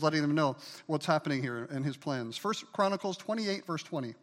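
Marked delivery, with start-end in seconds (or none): none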